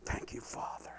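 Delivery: a soft prayer whisper; whispered thanks to the Father. Real, not acted. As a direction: breathe the thanks to God.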